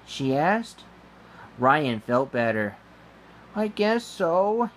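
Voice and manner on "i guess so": usual speaking voice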